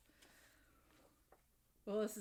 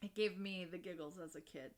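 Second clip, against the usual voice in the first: ordinary delivery, nothing marked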